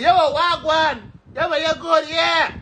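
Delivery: high, loud Jamaican street greeting; hyped greeting. Retold or imitated. Greeting a friend at the airport, high and loud. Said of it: "Me, I want to say".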